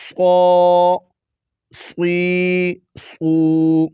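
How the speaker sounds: صو صی صو said thick and full-mouthed; heavy sad. Shown as a demonstration. Teacher showing students heavy صو صی صو. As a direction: round out صو heavily from the back of the mouth. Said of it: "اس کو ہم نے موٹا ہی پڑھنا ہے".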